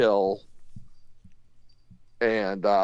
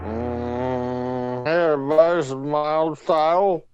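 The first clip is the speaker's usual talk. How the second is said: talking around something in mouth; mushy mouthful speech; marbles in mouth; 2 semitones higher than usual. Real, not acted. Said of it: "You got marbles in your mouth".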